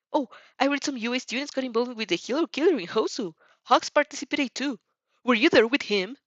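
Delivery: a fired-up rival promising to win; hammed-up hot-blooded boy on the phone; passionate boy. Inasa voiced by reader.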